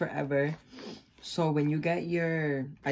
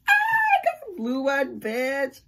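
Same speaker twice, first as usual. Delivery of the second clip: excited squeal; 9 semitones higher, 7 dB louder, slower than usual. Real, not acted. Finds blue one in package.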